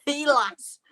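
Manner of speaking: bad mock-Yorkshire, drawn from a phrase list; terrible Yorkshire accent. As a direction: repeat it in hammed-up Yorkshire dialect. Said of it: "terrible Yorkshire accent".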